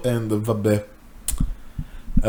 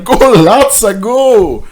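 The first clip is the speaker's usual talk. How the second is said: high, loud cheer; root for him like a fan at a rally; excited chant of encouragement; 11 semitones higher, 8 dB louder, slower than usual. Acted. Cheering on the character.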